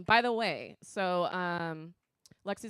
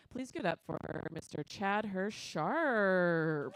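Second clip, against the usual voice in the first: announcer intro; slower than usual. Real, not acted. Introducing the comedian.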